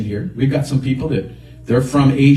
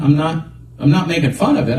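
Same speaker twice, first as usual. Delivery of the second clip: ordinary talk, nothing marked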